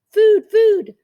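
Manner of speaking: put-on high, loud begging baby voice; high hungry peep. A narrator's reading. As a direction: beg for food like a tiny gosling. Hungry gosling begs.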